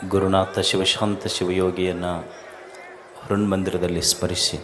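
low, soft, measured salutation with long pauses; quiet, low-pitched formal blessing; solemn invocation. Real, not acted. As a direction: open reverently, hushed and low, phrase by phrase. Opens discourse with invocation and salutation.

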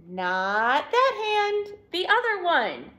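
high, drawn-out playful voice; playful guessing. A narrator's reading. Douglas and Dad playing a guessing game, high playful voice. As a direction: stretch it out high, teasing.

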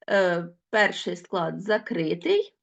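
ordinary delivery

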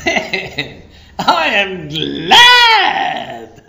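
laughing shout with a long drawn-out GLAD; exuberant gladness. Acted. Preacher imagines walking into Vegas, exuberant laughing shout. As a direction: burst with joy, stretching the last word high and loud.

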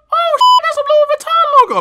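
high, loud surprised outburst; sudden high excited cry; shocked squeal. Real, not acted. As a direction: yelp at spotting the logo. Spots the logo.

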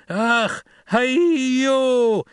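high, long ringing call across the sand; shouted traditional call. A narrator's reading. Paul calling the steersmen on the worm, shouted. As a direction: call it out loud and drawn out. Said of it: "he shouted in the traditional call".

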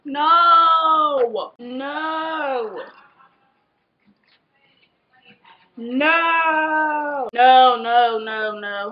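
loud high shocked no; horrified at the mirror. Real, not acted. Reacting to hair in mirror.

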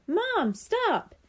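urgent but restrained shout to mom; mild shout. A narrator's reading. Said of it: "he shouted".

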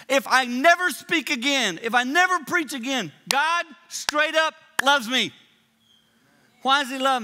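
loud, high preacher's declaration; rising preaching shout. Real, not acted. Preacher proclaiming God's love to the crowd, loud. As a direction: build it up and proclaim it.

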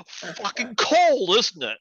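high, loud, drawn-out outburst; acted exclamation. Redhead answering the drunk, loud drawn-out exclamation. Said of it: "She goes".

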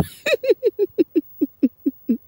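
long run of high giggles; real giggling laugh. Real, not acted. Vlogger amused by the snitching comment, giggling.

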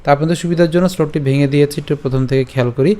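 ordinary talk

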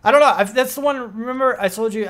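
ordinary delivery

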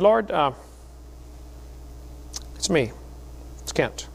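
tentative retold prayer; hesitant prayer. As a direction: re-enact a shy prayer with long pauses. Acted halting first prayer.

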